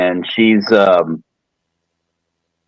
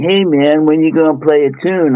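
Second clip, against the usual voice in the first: lightly voiced laid-back hippie; 6 semitones higher than usual. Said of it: "So my hippie voice character".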